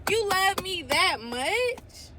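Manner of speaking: overwhelmed, asking in wonder; awed disbelief. Real, not acted. Overwhelmed by God's love.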